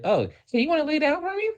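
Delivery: put-on falsetto doctor question; mocking, very high doctor voice; high-pitched doctor. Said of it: "he had a high-pitched ass voice".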